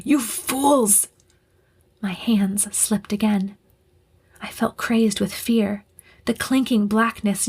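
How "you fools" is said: screaming. Acted. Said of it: "I heard the commander scream".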